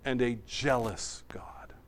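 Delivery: hushed whisper. Acted. Quoting Joshua, voice drops.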